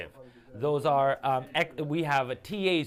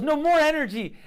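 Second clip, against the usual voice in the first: hammed-up exasperated orders, much higher and louder; mock irritated; 11 semitones higher, 6 dB louder than usual. Imitating a hungry colleague cutting off long takes, mock exasperated. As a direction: play the fed-up colleague, pitch up, pushing hard. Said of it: "he was so irritated".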